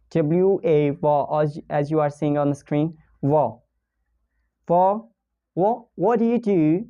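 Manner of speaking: ordinary talk, nothing marked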